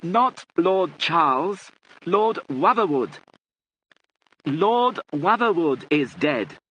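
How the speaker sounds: high, loud, very slow; emphatic correction. A narrator's reading. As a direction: hammer out each name for a deaf listener. Correcting the deaf lady loudly.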